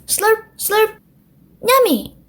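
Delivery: high slurp then yummy. A narrator's reading. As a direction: smack the lips over the milk. Peppa drinking milk.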